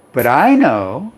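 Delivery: ordinary delivery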